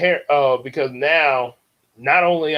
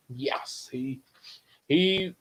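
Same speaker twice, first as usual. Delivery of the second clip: plain reading voice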